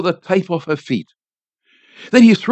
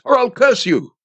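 raised-pitch threat; restrained yell; 6 semitones higher than usual. A narrator's reading. Priest threatens the old woman with a curse, mild yell. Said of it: "yelled the priest".

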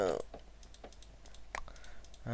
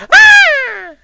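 shrill startled shriek; sudden high scream; 22 dB louder than usual. Real, not acted. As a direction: scream out in shock.

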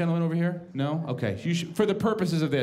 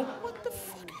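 a shocked, hushed curse; startled hushed curse; 12 semitones higher, 13 dB quieter, slower than usual. Retold or imitated. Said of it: "I go".

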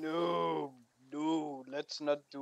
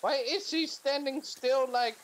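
incredulous; 10 semitones higher, 4 dB louder than usual. Real, not acted. Amused at game character.

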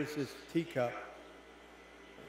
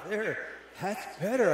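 relieved; 5 semitones higher, 6 dB louder, slower than usual. Acted. Teacup relieved cooling down.

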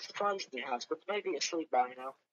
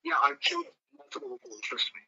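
mock menace; 10 semitones higher than usual. Boasting to scare the customer.

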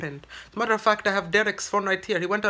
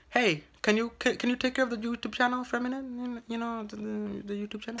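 attempted imitation accent, higher voice, fading softer; higher-voiced stab at his accent, trailing off quietly; Derek's accent; 4 semitones higher, 6 dB quieter, faster than usual. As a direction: try on another man's accent, rushed and higher. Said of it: "try my best to manipulate the accent".

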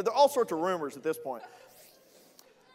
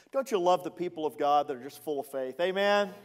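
ordinary talk, nothing marked

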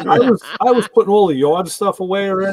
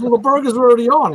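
quoting wife; 8 semitones higher, 4 dB louder than usual. Retold or imitated. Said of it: "She goes".

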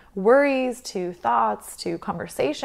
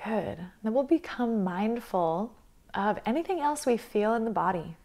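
guided meditation; 6 dB quieter than usual. Acted. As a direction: guide them calmly through the breath, leaving space between phrases.

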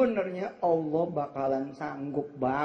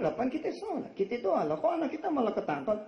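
bewildered complaint; 9 semitones higher than usual. Retold or imitated. Confused parents complaining.

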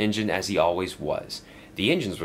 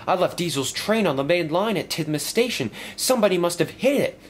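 higher, lively little engine; worried old engine asking Sir Topham Hatt, lightly raised engine voice; young engine voice; 10 semitones higher than usual. Acted. Stepney worried about repairs, asking his boss in a higher voice.

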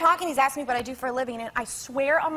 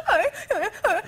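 high, panicky gasps for air, acted out; mock hyperventilating gasps; 7 semitones higher than usual. Passenger answers the stewardess, with mock hyperventilating gasps. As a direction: gasp in hammed-up hyperventilation. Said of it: "I'm hyperventilating".

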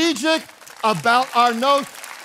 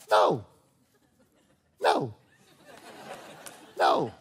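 playful pitch games; 9 semitones lower, 5 dB quieter, slower than usual. Shown as a demonstration. Playful varied noes.

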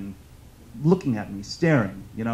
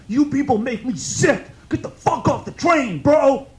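furious shout, much higher and louder; angry yell; 11 semitones higher, 10 dB louder than usual. Retold or imitated. Stranger yelling at him down the train car, furious shout. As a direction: bellow it like a hostile stranger across the car. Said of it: "He yells out".

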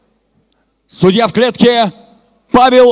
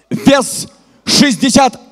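normal talking voice

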